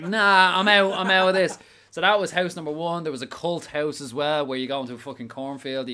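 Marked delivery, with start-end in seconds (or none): 0.0-1.6 louder and higher, half-laughing panic; scared bail-out. Retold or imitated. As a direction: blurt it like you're fleeing the room. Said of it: "I'm like".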